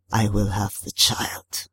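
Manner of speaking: low, slow, hoarse water spirit; waterlogged, ponderous creature; Tarabusao. A narrator's reading. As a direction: draw it out in a deep accented creature voice. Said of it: "hoarse, waterlogged, speaking in an accent so thick".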